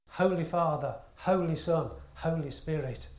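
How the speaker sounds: ordinary talk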